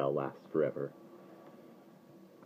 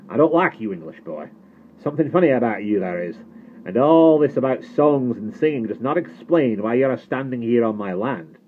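loud, higher, irate farmer; hostile accusation; 6 semitones higher, 9 dB louder than usual. A narrator's reading. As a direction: challenge the boy to explain himself. Suspicious farmer confronts trespassing boy.

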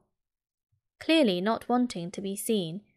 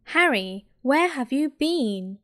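squeaking; 3 semitones higher, 4 dB louder than usual. A narrator's reading. Hermione greeting Harry after the match, slow squeak. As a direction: squeak it out anxiously. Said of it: "Hermione squeaked".

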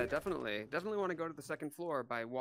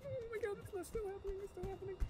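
high, terrified begging; being chased; 4 dB louder than usual. Real, not acted. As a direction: plead with the monster in panic.

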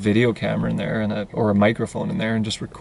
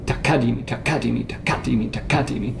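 "Takadimi" with the second syllable KA accented; stress on KA; faster than usual. Shown as a demonstration. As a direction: chant taKAdimi in a steady loop. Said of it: "put it on the second".

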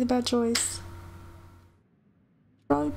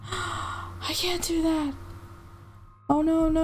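slow, high, anguished plea; slow, helpless refusal after a gasp; distressed refusal; 3 semitones higher, slower than usual. Real, not acted. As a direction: draw it out in real dismay. Repeats refusal after gasping.

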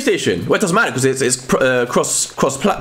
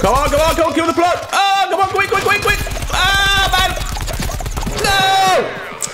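high, frantic urging turning to dismay; 14 semitones higher than usual. Real, not acted. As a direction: urge desperately, then cry out at the loss. Frantic urging, then losing.

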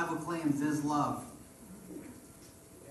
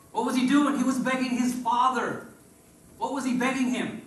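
rising preacher fervor; 6 semitones higher, 4 dB louder than usual. Real, not acted.

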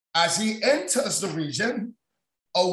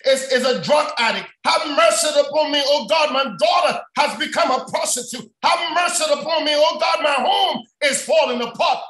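insistent preached plea, mounting higher and faster; desperate pleading; 3 semitones higher, 3 dB louder than usual. Acted. Mother begging God to save her family, desperate pleading. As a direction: cry out for mercy in rhythmic, raised appeals. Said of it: "It's like a mother saying".

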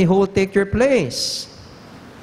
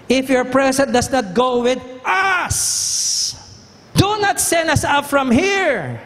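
desperate shout; 8 semitones higher, slower than usual. Acted. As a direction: cry it up to heaven. Moses pleading with God.

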